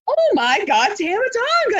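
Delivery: hammed-up starstruck cry; starstruck fan. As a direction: imitate an overexcited fan meeting an idol. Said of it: "like a Make-A-Wish kid".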